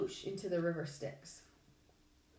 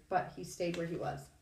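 ordinary delivery, nothing marked

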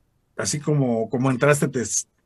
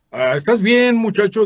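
imitating an older director, higher and louder; voz del director; 7 semitones higher, 6 dB louder than usual. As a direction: mimic the director's concerned question. (imitando al director, más agudo y fuerte)

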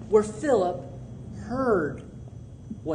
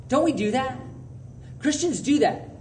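ordinary talk, nothing marked

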